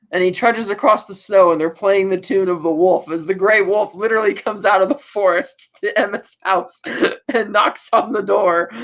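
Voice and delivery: usual speaking voice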